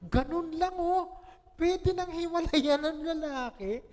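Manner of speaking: exaggerated mock disgust; mock outrage. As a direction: ham up a scandalized husband's complaint. Mocking husband's view of messy wife.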